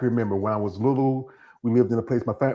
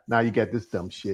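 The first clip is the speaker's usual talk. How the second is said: usual speaking voice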